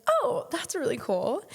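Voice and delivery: high, drawn-out polite approval; feigned enthusiasm. Retold or imitated. Girlfriend answering his horseback riding surprise, feigned enthusiasm.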